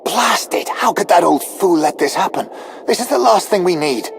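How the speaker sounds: high-pitched angry cursing; exasperated outburst. Acted. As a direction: vent frustration at someone's blunder. Officer curses the blunder before apologizing.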